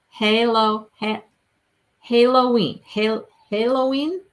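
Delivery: Halloween said as "HAY-lo-ween", like halo; attempted radio pronunciation. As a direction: try out Halloween with "hay" up front, unsure. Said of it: "Something like that".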